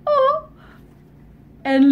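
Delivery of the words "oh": high, slow storybook gasp of wonder; high wide-eyed astonishment; alarmed amazement. Acted. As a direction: raise the pitch and stretch each word. Red alarmed by big teeth.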